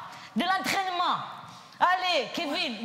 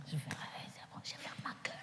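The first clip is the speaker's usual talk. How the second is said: whispered aside; 18 dB quieter, much faster than usual. Real, not acted. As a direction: breathe it out under the breath. (chuchoté)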